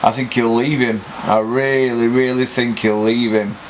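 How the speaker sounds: ordinary talk, nothing marked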